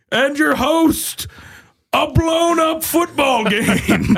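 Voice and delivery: high, very slow announcer build; Don Pardo. Acted. Announcer introduces the host, grand drawn-out voice. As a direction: sustain each word like a show opener. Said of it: "I'm Don Pardo".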